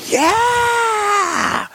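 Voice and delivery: put-on high, bursting child's shout of yes; excited kid yell. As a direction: shout yes like a thrilled six-year-old. Kids answer about cheese pizza.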